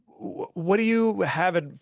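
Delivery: usual speaking voice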